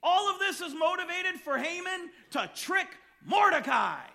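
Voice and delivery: loud, high sermon emphasis; a preacher shouting the point; full-force preaching. Real, not acted. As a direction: drive the point home to the room.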